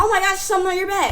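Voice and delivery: put-on high, fast kid's taunt; mock excited prank call. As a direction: blurt it out high and quick. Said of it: "She sit down".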